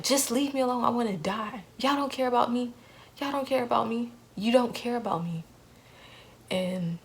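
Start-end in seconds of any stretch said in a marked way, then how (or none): 0.0-5.4 higher, hurt cry that they don't care; desperate outburst. Retold or imitated. To her family, a higher hurt outburst. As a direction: lash out in despair. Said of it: "I'll never forget".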